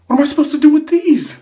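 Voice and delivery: high, loud, bewildered protest; appalled outcry. Acted. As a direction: blurt it out loudly in high-pitched dismay. Appalled at finding tampons as gift.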